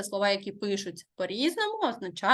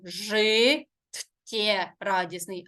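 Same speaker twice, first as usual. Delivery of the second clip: "Життєрадісний" slowly, pausing after "жи" and keeping "ттє" together; drawn-out "жи", a break, then "ттєрадісний"; correct syllable split; much slower than usual. Shown as a demonstration. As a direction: break "Життєрадісний" after "жи", before the long consonant. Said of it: "ми подовження не розриваємо".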